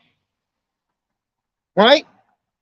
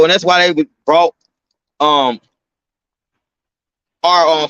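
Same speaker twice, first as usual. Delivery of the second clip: ordinary delivery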